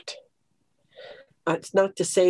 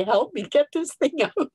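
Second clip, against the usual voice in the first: high-pitched mock plea for help; retold plea; 8 semitones higher than usual. Speaker retelling asking somebody to pull the thistle out, high pleading.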